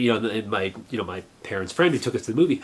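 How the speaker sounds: usual speaking voice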